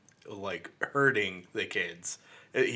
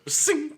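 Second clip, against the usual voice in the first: mock boner sound; 3 dB louder than usual. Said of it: "it goes".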